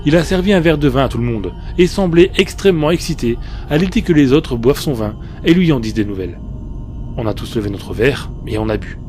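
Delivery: plain reading voice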